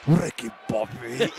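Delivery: put-on French accent. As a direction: imitate the French racer drawling Ricky Bobby. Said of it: "always says it very, very French".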